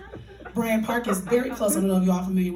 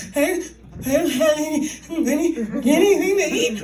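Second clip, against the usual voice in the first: high, loud comic wail; mock sobbing; 7 semitones higher, 6 dB louder, slower than usual. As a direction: beg through exaggerated ugly crying. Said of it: "I'm just sobbing".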